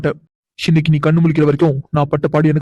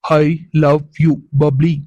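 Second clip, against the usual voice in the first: "I love you" stretched very slow, word by word; drawn out slowly; much slower than usual. Acted. Husband repeating to teasing wife, very slowly. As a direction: spell it out slowly so she can't miss it. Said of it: "எது?".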